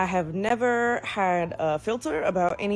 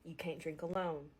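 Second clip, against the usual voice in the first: hushed, low, much softer warning; ominous school counselor; 3 semitones lower, 16 dB quieter than usual. Retold or imitated. School staff warning teens, hushed ominous murmur. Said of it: "they just go".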